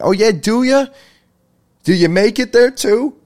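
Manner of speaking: high, loud sarcastic comeback; sarcastic mock surprise. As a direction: fire back in mock disbelief. Mock reply to the pizza shop.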